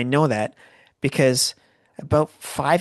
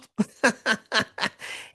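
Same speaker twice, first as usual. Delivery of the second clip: laugh; 9 semitones higher than usual. Real, not acted. Laughing after the yelled line.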